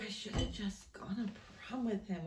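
breathy regretful whisper. Real, not acted. Wistful regret, unvoiced.

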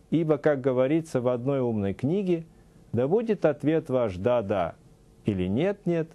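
ordinary talk

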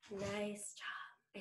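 soft whispered praise. Shown as a demonstration. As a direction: barely breathe it. Whispered praise in quiet phase.

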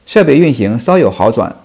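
ordinary talk, nothing marked

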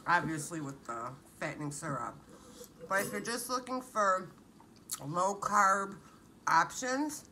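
speaking between bites; talking while chewing. Real, not acted. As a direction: mouth partly full of food. Seen 1: Eats before; lips look closed mid-chew at 0.9 and 3.2-3.5.

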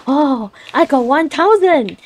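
mock thrilled cry over a thousand meat; retold excitement. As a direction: savour the big number with delight. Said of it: "I was like".

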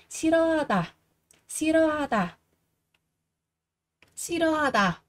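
"Sirohada" with the first syllables linked into "si-ro", H dropped; teacher models linking after explaining silent H, smooth "Sirohada". Shown as a demonstration. Slide "Sirohada" together as si-ro, the written H left silent. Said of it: "coi như là âm câm".

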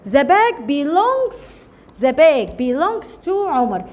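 very slow, word by word; teacher dictating the English sentence very slowly; slow dictation. Shown as a demonstration. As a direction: spell it out slowly for the class.

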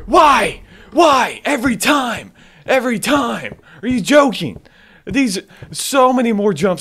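high, loud outraged wail; exasperated yelling. Real, not acted. Player protesting repeated jump scares, exasperated yelling.

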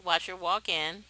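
usual speaking voice

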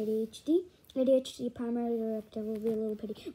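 low, quick monotone recital; droning directive. Acted. 80-HD reciting its primary directive, low quick drone. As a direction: drone it like a programmed machine.

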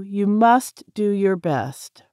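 ordinary delivery

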